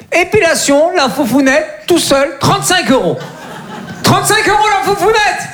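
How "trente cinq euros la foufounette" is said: high, laughing outrage at the price; high-pitched indignant disbelief; outraged. Acted. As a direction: shriek it like a ripoff scandal.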